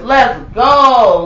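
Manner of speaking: loud, drawn-out hype call; hammed-up rapper's holler, stretching "go". Host rallying to report the manager, rapper-style hype. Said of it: "in my [name] voice".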